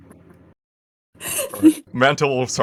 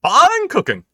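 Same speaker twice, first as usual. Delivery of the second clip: mimicked chef voice; 11 dB louder, slower than usual. Parrot mimics a voice.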